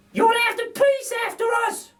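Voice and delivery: a cheeky cockney burglar lady; Burglar Betty. A narrator's reading. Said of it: "says Burglar Betty".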